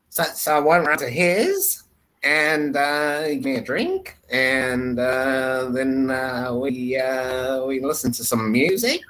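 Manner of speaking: mock Australian uptalk, phrase ends shooting up. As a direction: imitate an Australian making each statement a question. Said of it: "a rising intonation at the end of every sentence".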